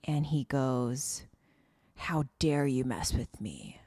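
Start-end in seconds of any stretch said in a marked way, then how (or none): 1.9-3.8 low, slow menacing killer voice; menacing villain. Retold or imitated. Recapping the villain who finds her, low slow menace. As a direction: lean into a slow threat.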